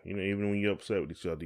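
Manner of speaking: ordinary delivery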